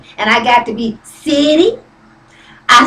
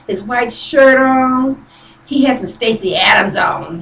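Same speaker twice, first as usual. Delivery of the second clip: ordinary talk, nothing marked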